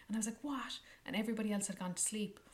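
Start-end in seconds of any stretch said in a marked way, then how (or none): 0.4-0.8 high, startled question; startled what. Retold or imitated. Answering the sailor, startled. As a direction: react in surprise.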